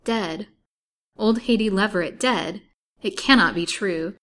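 loud, startled disbelief; astonished, emphatic, barely believing it; incredulous joy. A narrator's reading. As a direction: exclaim it as news too good to believe. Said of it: "with incredulous joy shining in her eyes".

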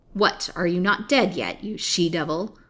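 fierce. Acted. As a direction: attack the prisoner with fierce contempt. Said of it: "was the fierce salutation".

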